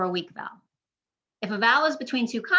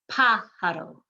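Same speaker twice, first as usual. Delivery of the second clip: "pájaro" with PA hit hard; stress on first syllable; 3 dB louder, slower than usual. Shown as a demonstration. Teacher correcting the mistake, "pájaro" stressed on PA. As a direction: stress PA in "pájaro", the rest light. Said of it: "emphasizing that first syllable".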